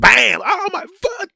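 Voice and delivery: loud, very high mock yelp; pained yelp. Homeowner stubbing his foot in the dark, mock yelp. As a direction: yell like you stubbed your toe.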